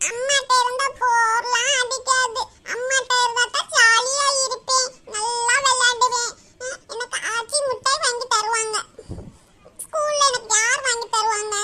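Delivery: put-on whining child arguing back; insistent little-girl wail, acted; crying child. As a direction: argue tearfully, stretching the word endings. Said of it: "அழுது அழுது மூஞ்சி பூரா".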